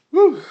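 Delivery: loud tired exhale; tired whew. Real, not acted. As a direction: blow out a weary whew. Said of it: "I'm tired".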